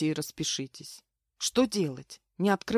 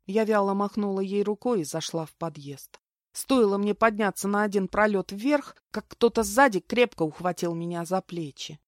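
ordinary delivery, nothing marked

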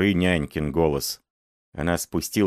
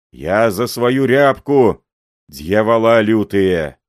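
put-on louder furious roar at the old man; angry growl; 4 semitones higher, 6 dB louder than usual. A narrator's reading. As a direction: roar accusations at him. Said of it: "рык-зык этот".

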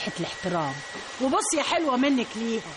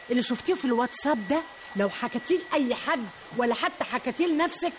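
ordinary talk

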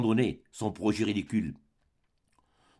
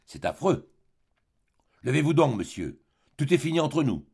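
ordinary delivery, nothing marked

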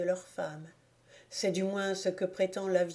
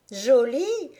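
shrill, much higher, much louder outraged yelp; indignant cry; 6 semitones higher, 7 dB louder than usual. A narrator's reading. Elder Stock sister scoffing at the old woman, loud indignant cry. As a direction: spit the word back in scorn. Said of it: "s'écria le numéro un".

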